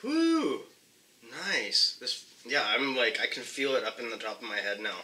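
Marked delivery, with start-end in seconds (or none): 0.0-0.6 sharp high exhale from the heat; hot whew. Real, not acted. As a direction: blow out the burn with a whew. Gasp after eating hot pepper.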